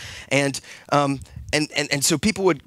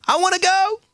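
put-on high, eager child's voice; little girl begging; 4 dB louder than usual. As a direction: plead like a small girl.

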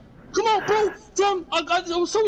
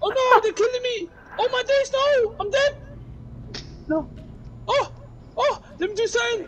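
scared horror game streamer; 6 semitones higher than usual. Real, not acted. Zombie grabbing him in game.

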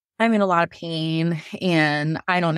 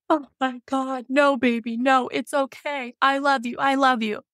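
tender dad; 6 semitones higher than usual. Retold or imitated. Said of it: "he was like".

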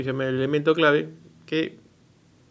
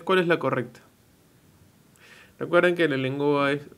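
normal talking voice

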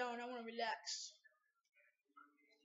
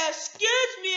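"Excuse me" blurted out higher and louder; 8 semitones higher, 13 dB louder than usual. Pretend. Child prompted again, shouting the apology. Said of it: "What do you say?".

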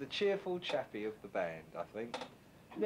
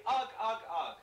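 monkey grunts; 6 semitones higher, 5 dB louder than usual. Shown as a demonstration. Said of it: "go say".

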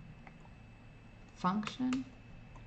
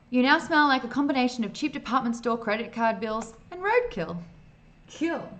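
excited; 5 semitones higher, 6 dB louder than usual. Acted. Reading game text with glee.

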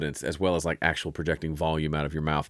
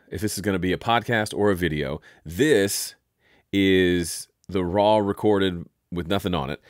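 ordinary talk, nothing marked

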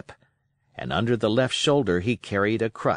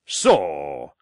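low, drawn-out exclamation; growing indignation in one word; rolling sailor's "so"; Silver crying out; 7 semitones lower, 4 dB louder than usual. A narrator's reading. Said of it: "cried Silver".

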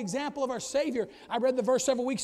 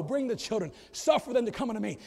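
ordinary talk, nothing marked

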